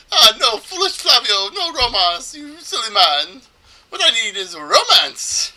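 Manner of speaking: put-on high, loud, melodramatic Italian accent; bad Italian accent. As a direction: scold yourself as a hammy Italian trader. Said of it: "supposed to do an Italian accent".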